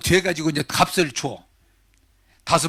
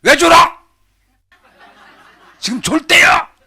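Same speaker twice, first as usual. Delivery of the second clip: loud, high-pitched retold angry yell; angry outburst; 11 semitones higher, 10 dB louder, slower than usual. Early workers protesting the master's pay, angry shouting. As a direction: act out a worker exploding at the boss. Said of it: "성질이 나가지고".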